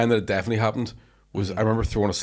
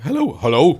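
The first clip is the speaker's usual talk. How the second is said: loud, higher pretend phone greeting; mock phone hello; 7 semitones higher, 8 dB louder than usual. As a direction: call down the line, play-acting. Acted call to girlfriend's dad.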